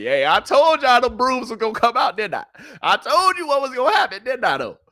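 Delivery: high, excited told-you-so; triumphant bragging; gloating. Real, not acted. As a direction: crow about being right, voice way up. Gloating about his correct pick.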